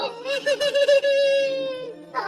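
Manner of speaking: hammed-up blubbering cry; mock wailing sob. Betrayed husband blubbers in a hammed-up cry. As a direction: wail it out comically.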